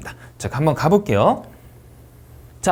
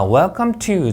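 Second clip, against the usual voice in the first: slow, higher WELCOME TO as one group; linked phrase reading; 6 semitones higher, much slower than usual. Shown as a demonstration. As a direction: run WELCOME into TO without a break. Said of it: "묶어 읽기가 나왔습니다".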